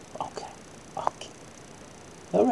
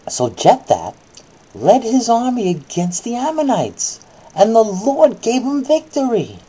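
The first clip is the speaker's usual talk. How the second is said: put-on reading to a child; 4 semitones higher, 4 dB louder than usual. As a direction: clear storybook reading voice. Reads Bible story to child.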